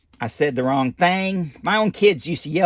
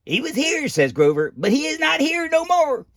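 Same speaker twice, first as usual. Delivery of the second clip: Grover voice; 12 semitones higher than usual. Acted.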